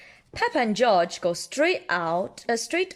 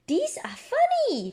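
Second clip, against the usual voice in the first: high, bright-eyed little pig voice; Peppa voice. Acted. Parent reading Peppa's line to a child, high little pig voice. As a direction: squeak it out like Peppa.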